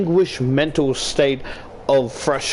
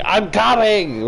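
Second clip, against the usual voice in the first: drawn-out, raised call; mock yell; 7 semitones higher, slower than usual. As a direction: call it out slowly, as if yelling back to mother. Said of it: "like, yelling".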